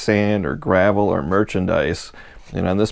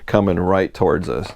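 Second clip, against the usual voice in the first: ordinary talk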